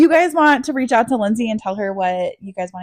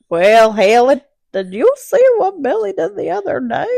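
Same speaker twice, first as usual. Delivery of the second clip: high, louder granny gossip; gossipy old lady; 5 semitones higher, 6 dB louder than usual. Pretend. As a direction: ham up a nosy old woman. Playing old-lady gossip character.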